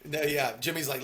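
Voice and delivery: normal talking voice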